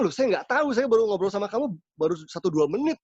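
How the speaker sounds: high, startled retelling of his own answer; startled reply. Retold or imitated. Preacher retelling his startled answer to the boy, high and startled. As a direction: replay the shock of being asked. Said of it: "Waduh, saya kaget".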